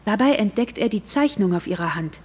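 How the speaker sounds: ordinary talk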